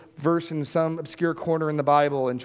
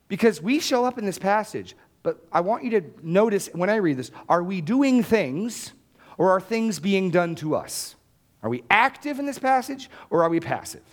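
usual speaking voice

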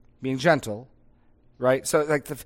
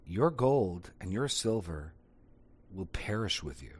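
solemn; 5 semitones lower, 9 dB quieter than usual. Shown as a demonstration. Preacher showing how Peter might rebuke Simon, solemn. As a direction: say it solemnly, softer and lower, with pauses. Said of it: "say that in a solemn".

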